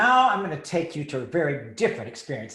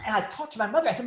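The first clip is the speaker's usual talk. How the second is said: normal talking voice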